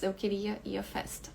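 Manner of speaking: ordinary talk, nothing marked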